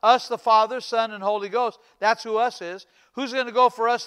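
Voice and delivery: ordinary talk, nothing marked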